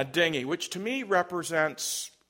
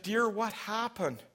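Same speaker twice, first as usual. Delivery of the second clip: imitating a let-down mother, voice lifted higher; higher-pitched mock motherly disappointment; disappointed mom; 6 semitones higher than usual. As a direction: gently voice a disappointed mom's question. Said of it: "Her mom's disappointed".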